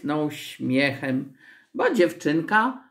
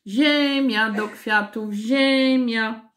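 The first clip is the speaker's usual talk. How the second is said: street vendor's drawn-out cry, raised pitch; higher, sing-out vendor call; 7 semitones higher, slower than usual. A narrator's reading. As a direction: hawk it down the street like a carter. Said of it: "a gospodarz wołał".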